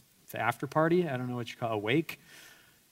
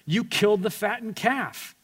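a higher bitter accusation; resentful elder son; 8 semitones higher than usual. Acted. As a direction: accuse him, higher than your own voice. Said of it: "the real reason he's upset".

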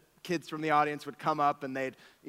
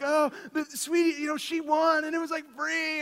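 much higher, sing-song comfort; soothing parent; 10 semitones higher than usual. Retold or imitated. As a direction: coax a crying toddler. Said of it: "and I'm like".

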